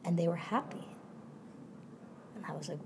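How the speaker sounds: ordinary delivery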